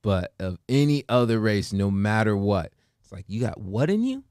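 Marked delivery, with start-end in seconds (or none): 3.3-4.2 mock incredulous questioning. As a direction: pitch climbing sharply on the questions. Acting out nosy questioning about ancestry.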